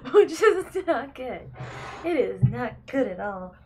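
real laugh through the words. Real, not acted. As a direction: laugh at how bad it is. Laughing at terrible frame rate.

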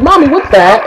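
put-on high little kid asking; curious small child. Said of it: "They'll be like".

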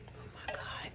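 breathless whisper of delight; savoring a smell. Real, not acted. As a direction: whisper it like you just smelled heaven. Smelling favourite candle.

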